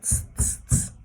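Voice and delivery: three sharp, forceful "tss" hisses; monkeys' tutting. A narrator's reading. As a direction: mimic cross monkeys with strong tsks. Monkeys mimic the shouting peddler.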